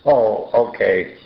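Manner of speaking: usual speaking voice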